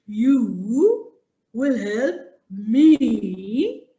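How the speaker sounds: a big lion amused but stern; lion laughing off the mouse's promise; mocking lion. Lion mocks then warns.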